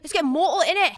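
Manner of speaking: high mock Geordie girl; rally them in a Geordie accent; imitated Geordie accent. Reality show girl proposing a night out, Geordie accent. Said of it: "this girl would be like".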